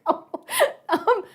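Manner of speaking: high, slow reply through laughter; bewildered thanks. Retold or imitated. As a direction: imitate a stunned suitor while cracking up. Acting his bewildered reply, laughing.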